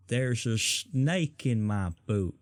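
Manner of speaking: very slow mock Deep South drawl; Deep South accent. Brit quoting Toy Story, mock Deep South drawl. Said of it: "Deep South".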